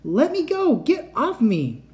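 very high, loud, slowing struggle; panicked scream. Acted. Cat struggles in the robot's grip, screaming high and loud. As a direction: scream to break free.

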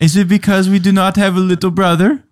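put-on a kid asking why his father leaves; hurt child. Child asks Napoleon if it's about a brother, in a high hurt voice.

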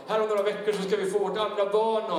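high-energy, driving testimony from the pulpit; impassioned preaching. Acted. Preacher testifying to the congregation about God's strength, impassioned. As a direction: preach it with urgent, sustained projection.

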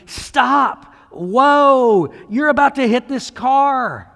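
retold warning shout. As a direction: yell to stop the driver. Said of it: "They were trying to tell me".

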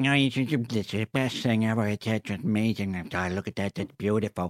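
a light Adam Sandler-style American voice; gushing in a lightly put-on Sandler voice; Adam Sandler impression. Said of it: "that was spot on, that accent".

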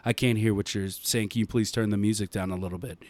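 soft, low, polite customer-service voice, a bit fast at the end; meek apologetic drive-thru voice; hushed low customer service politeness; polite quiet worker voice. Retold or imitated.

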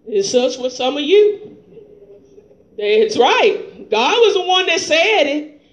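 impassioned sermon delivery, laughing through it; fiery preaching. Real, not acted. Pastor exhorts his church in full-force preaching. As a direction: preach it hard with rising fervour.

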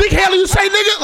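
loud, high, laughing call-out; teasing shout at a friend. Real, not acted. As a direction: yell it across the room through laughter. Laughing call-out across room.

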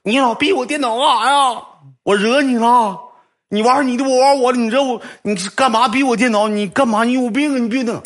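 mock outraged girl berating a stranger; angry woman scolding. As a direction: tell him off like a furious young woman.

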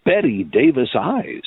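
ordinary delivery

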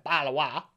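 "Balawa" run together with the t's dropped; mock British "Balawa", the t's swallowed; swallowed British bottle of water. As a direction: squash "Balawa" into one word, no t's at all. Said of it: "a bottle of water ... laughs with my American friends".

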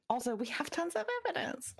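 high whispered sarcastic aside. Real, not acted. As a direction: whisper it dryly, as a snide aside. Sarcastic aside on court ruling.

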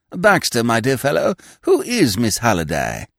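put-on high, fluttery voice of a vague old lord; a dithering aristocrat caught off guard, pitched high; flustered old earl. Vague old earl startled by his secretary, high and flustered. Lord Emsworth questioning Baxter.

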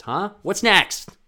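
loud, high demanding taunt; pushy inner voice. Retold or imitated. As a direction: bark it at yourself. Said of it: "that's me talking to myself".

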